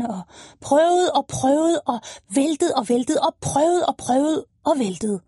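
slowed, tired retelling; slow repeating. Acted. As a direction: stretch each try to show how long it took. Lina retells trying again and again.

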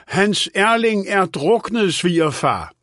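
high, drawn-out call; mildt råb. A narrator's reading. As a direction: call it out slowly to someone who won't listen. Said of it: "råbte hun". (råbende, højt toneleje)

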